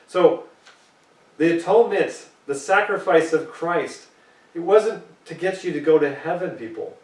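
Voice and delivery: ordinary delivery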